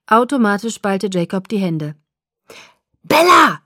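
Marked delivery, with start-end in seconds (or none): 3.0-3.6 high, loud call from afar; shouting from the house. A narrator's reading. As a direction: yell her name across the yard. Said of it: "brüllte Charlie vom Haus her".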